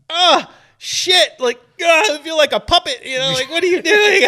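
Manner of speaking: high, squeaky mock voice of his own jerking muscle; puppet voice. Talking about acupuncture pins, voicing the twitching arm in a high silly puppet voice. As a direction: pipe up like a confused puppet on strings.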